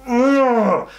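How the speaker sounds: high, falling groan of annoyance; exasperated "ugh". Acted. Disgusted reaction to the character.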